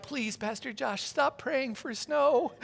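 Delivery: a hint of whiny begging, slow at the end; mock pleading. Congregation imagined begging the pastor, mock pleading. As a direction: plead softly, dragging out snow.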